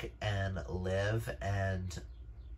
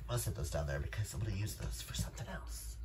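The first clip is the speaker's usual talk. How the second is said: quick, soft whispered aside; hushed offhand remark; 9 dB quieter, much faster than usual. Real, not acted. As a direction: mutter it under your breath while moving things. Whispered aside while arranging books.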